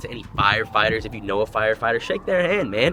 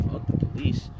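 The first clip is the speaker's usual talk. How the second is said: whispered aside; 4 dB quieter than usual. Pretend. Muttered aside.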